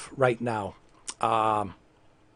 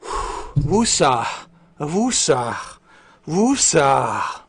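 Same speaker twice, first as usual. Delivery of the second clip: calming mantra; much slower than usual. Pretend. Streamer calming himself after rant, mock-soothing mantra. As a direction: breathe out the temper with each repeat.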